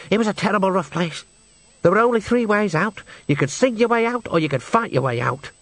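lightly played singer reminiscing; lightly voiced singer Henry. A narrator's reading. Henry describes his rough home to the witches, lightly voiced character.

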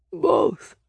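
high, creaky ancient reply; old, scratchy voice. A narrator's reading. Nameless answers Nick's question about the name, creaky. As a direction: answer curtly as a creaky elder. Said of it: "their voice so old and scratchy".

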